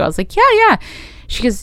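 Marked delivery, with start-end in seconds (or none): 0.4-0.8 high-pitched eager reply; eager agreement. Retold or imitated. Actress replying to casting director's invitation, eager. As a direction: chirp it, much higher than usual.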